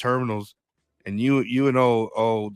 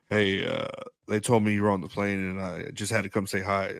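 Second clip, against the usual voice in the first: lower, softer mock attendant; mock shy greeting; 6 semitones lower, 5 dB quieter than usual. Flight attendant greeting the star, soft and sheepish. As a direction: say it low and sheepish. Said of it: "flight attendant's like".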